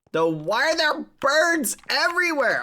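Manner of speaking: high squeaky puzzled voice; cute anime streamer. Acted. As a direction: squeak it like a confused cartoon. Squeaky surprised question.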